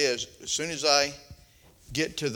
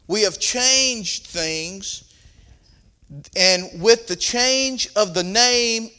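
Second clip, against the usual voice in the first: raised pulpit preaching voice; 5 semitones higher, 6 dB louder than usual. Acted. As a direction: preach it to the congregation.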